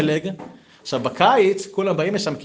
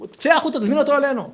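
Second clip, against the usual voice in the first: high, fast, pushy wife's voice; urging wife; 13 semitones higher, 4 dB louder, faster than usual. Acted. Wife sending her husband out to invite him, high and urgent. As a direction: hurry him out the door, high and quick.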